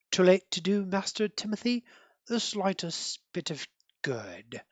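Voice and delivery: soft, posh British butler; grave posh British accent, slowing and sinking at the end; posh British Alfred. Acted. Butler solemnly answers his young master, soft posh British accent. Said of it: "posh British accent".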